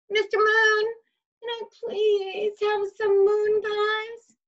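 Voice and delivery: put-on high, slow sing-song child voice asking nicely; polite child asking. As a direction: sweetly sing out a polite request. Jimmy politely asks the moon.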